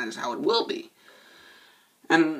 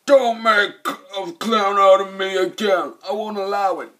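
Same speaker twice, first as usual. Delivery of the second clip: loud, higher angry rebuke; pissed-off cop; 6 semitones higher, 6 dB louder than usual. Retold or imitated. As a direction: bark it like a furious captain. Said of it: "Robert Davi's pissed at him".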